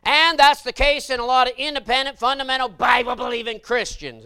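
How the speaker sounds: loud, high preacher's holler; full-force preaching. Acted. Preacher warning the congregation about temptation, hollering. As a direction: drive it home to the pews.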